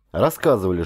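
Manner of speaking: ordinary delivery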